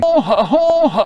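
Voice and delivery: high, loud laughing whoops; laughing shout. Retold or imitated. Child running up the street, shouting with laughter, high and loud. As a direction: cackle it out while running. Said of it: "ran off up the street shouting".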